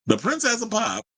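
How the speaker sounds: the Princess of Pop, announced high and grand; mock grand title. Host mockingly crowning the singer, hammed-up grand voice. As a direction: hype the title up like an emcee.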